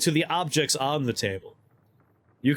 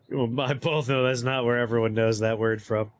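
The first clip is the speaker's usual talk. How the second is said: usual speaking voice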